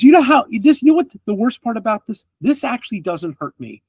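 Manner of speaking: man chides the party for the mess; scolding NPC. Acted. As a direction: lecture them on the trauma. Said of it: "And he goes".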